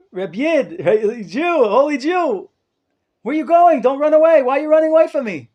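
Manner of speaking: high, loud call across the street. Retold or imitated. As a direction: call out loud after someone running away. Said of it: "He screams to him".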